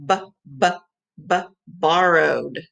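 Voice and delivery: "B, b, b, borrowed" with the b stressed; sounding out B. Shown as a demonstration. Teacher sounding out the first letter for kids. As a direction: tap out the b before the word. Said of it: "sounding out B".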